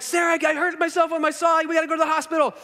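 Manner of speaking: high urgent call, voice pushed up; yelling for wife. Retold or imitated. Bleeding man calls to his wife, urgent high yell. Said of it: "I yell for my wife".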